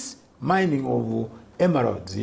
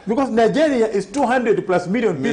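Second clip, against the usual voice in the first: laughing; 8 semitones higher than usual. Real, not acted. Laughing while explaining the numbers.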